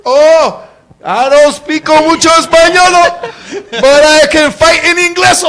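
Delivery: high, loud Spanglish taunt; acted fighting challenge. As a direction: throw down a mock threat, imitating a feisty girlfriend. Acts out girlfriend's fighting words again.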